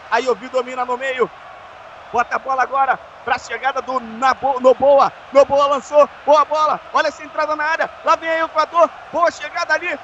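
ordinary delivery

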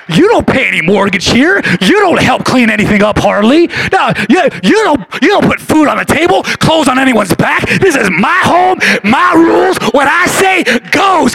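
loud parent laying down rules; booming motivational speech. Acted. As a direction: boom it like a strict parent. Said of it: "Come on, parents".

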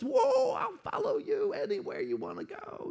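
very high squealing eagerness; excited volunteer. Pretend. Excited man promising Jesus to follow anywhere, squealing. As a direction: gush like a starstruck fan. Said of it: "Imagine you're this guy. You're excited".

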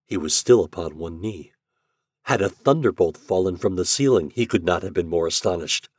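ordinary delivery, nothing marked

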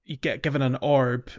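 normal talking voice

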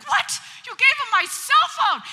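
high, loud incredulous cry; retold outrage at the husband; you did what, disbelieving yell; shocked disbelief.

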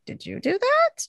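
high-pitched imitated question; high, mock-incredulous quote; retold accusing question. Retold sister confronting a man.

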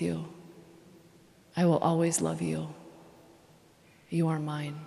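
soft, low, unhurried vow with long pauses; tender promises. Acted. God speaking softly to his people, tender vow. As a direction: speak each promise gently, like a lifelong pledge.

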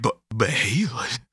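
hushed, unvoiced mock disbelief; barely voiced, imitated shock; stunned whisper. Acting out character's stunned reaction.

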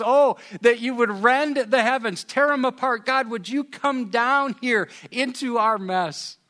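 loud, high cry to heaven; Isaiah's plea. Retold or imitated. Isaiah crying out to God to rend heavens, loud. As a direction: plead with God to come down. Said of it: "Isaiah looks up and he says".

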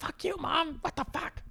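put-on squeaky high kid shrieking at mom; mock bratty kid. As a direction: squeal it like a spoiled brat.

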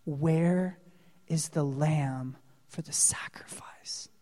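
put-on a boy whispering to his father; whispered child's question. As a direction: whisper the boy's innocent question. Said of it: "this one probably knocked Jesus down".

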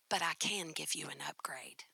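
sly hushed upgrade offer; a manager whispering a secret deal; whispered offer. Retold or imitated.

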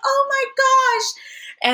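very high, slow squealed gasp. Retold or imitated. Sister-in-law squealing at the wrong pads, high squeal. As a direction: squeal it out in shock. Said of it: "squeal and she's like".

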